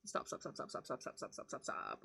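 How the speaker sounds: soft, rapid whispered stops fading away; telling her own racing mind to stop in a fading whisper; whispered self-hush. Retold or imitated. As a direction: whisper stop to yourself over and over. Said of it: "That's when I'm like".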